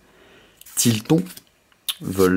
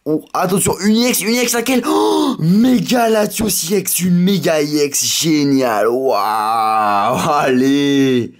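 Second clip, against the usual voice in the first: much higher and louder, overjoyed; très excité; 4 semitones higher, 4 dB louder, slower than usual. Real, not acted. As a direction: shout with joy at the rare card. Rare ultra card pulled. (excitation débordante, voix haute)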